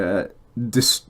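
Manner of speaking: ordinary delivery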